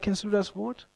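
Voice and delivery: ordinary delivery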